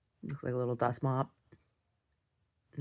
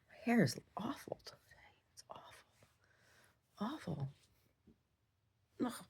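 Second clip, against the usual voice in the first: whispered; 7 semitones higher, 10 dB quieter than usual. Real, not acted. Whispered complaint.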